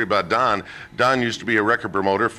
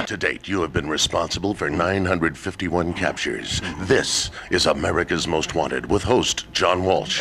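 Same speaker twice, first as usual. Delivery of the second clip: low, serious true-crime host; 8 semitones lower, 3 dB quieter than usual. Retold or imitated. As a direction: intone it like a TV crime show host. Host imitates TV show host.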